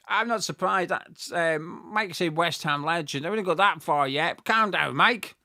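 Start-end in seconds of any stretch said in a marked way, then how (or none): none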